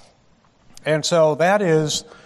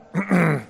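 quick throat clear after choking; 4 semitones higher than usual. Real, not acted. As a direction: clear your throat before going on. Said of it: "and then I choke on it".

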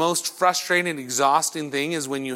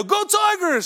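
sudden high-pitched fan yell; screamed cheer. Retold or imitated. Fan hollering after he hits the ball, screamed cheer. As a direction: imitate a heckler yelling at golf. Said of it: "Stop screaming so loud".

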